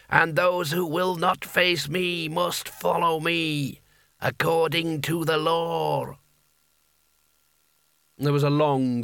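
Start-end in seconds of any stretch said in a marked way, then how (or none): 0.1-6.2 slow, raised proclamation of a possessed sorcerer; Abrim. A narrator's reading. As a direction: declare the challenge in stretched, ceremonial words. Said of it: "he said in the hat's voice".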